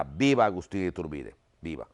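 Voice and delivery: normal talking voice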